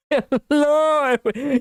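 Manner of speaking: mock YouTuber greeting; Markiplier impression. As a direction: belt the hello up high. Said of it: "like he does on the".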